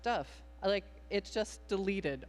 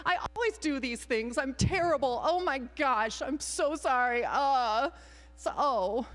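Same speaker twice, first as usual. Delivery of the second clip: hammed-up gushing remorse, higher; overdone apology; 7 semitones higher than usual. Shown as a demonstration. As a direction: make your sorry all about yourself. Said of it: "I made that totally about me".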